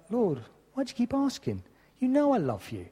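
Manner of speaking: lightly voiced upset disciple asking why; upset Peter. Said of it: "Peter's starting to get upset".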